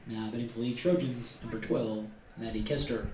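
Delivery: echoing stadium announcer. Real, not acted.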